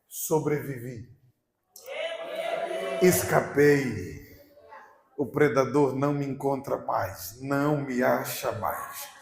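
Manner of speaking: low, very slow, drawn-out declaration; deer's triumphant relief. Acted. Preacher voicing the escaped deer, slow triumphant relief. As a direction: savour each word slowly, stretching the vowels.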